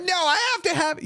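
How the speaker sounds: high, whiny mock-desperate insistence; desperate addict. Emotional eater told they'll be fine, desperate addict. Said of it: "You sound like an addict".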